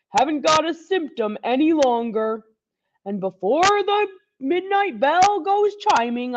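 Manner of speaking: put-on a proud old Santa insisting; hearty Santa. Santa speaks in held voice.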